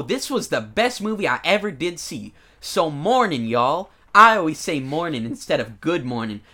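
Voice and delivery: put-on southern accent. Podcaster reads a fan's movie review in Southern accent. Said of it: "these reviews have, like, a southern accent".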